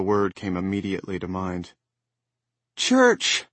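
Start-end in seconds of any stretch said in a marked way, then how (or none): 2.8-3.5 put-on high, loud child's call. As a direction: call the cat brightly. Child calling the cat.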